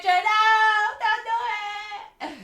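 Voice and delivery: playful shouted protest, almost crying; mock panicked plea. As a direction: beg them not to, hammed up.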